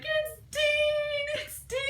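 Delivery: high, loud sung-out squeal of joy; excited squeal. Retold or imitated. Fan reliving Dean's arrival, squealing with excitement. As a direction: squeal the name like a thrilled fan. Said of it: "I was so excited".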